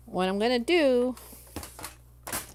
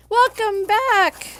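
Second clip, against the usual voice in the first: high, loud, slow sing-song welcome; stretched cheerful welcome; cheery greeting; 11 semitones higher, 9 dB louder, slower than usual. Real, not acted. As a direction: call out a bright hello to viewers. Greeting viewers, loud high call.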